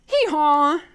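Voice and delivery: loud donkey bray imitation; chirped hee-haw. Little bird chirping out a donkey's call, loud hee-haw. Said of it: "and the bird chirped".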